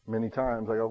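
ordinary talk

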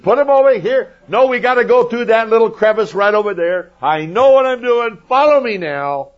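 loud, high herder's yell; mock exasperated hollering. Moses chasing sheep and goats, exasperated high yelling. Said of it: "I can see him chasing down".

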